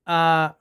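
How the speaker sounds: short relaxed "आ" schwa; schwa sound. Shown as a demonstration. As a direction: say "आ" as a short, lax uh with a relaxed mouth. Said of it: "इसको लंबा नहीं करना".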